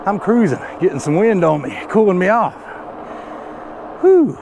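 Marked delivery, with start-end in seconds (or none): 4.0-4.3 loud high exhale, falling off; winded whew. Real, not acted. Climbing a steep hill on a scooter, winded loud whew. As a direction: blow out hard like you're spent from climbing. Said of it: "I'm plumb out of breath".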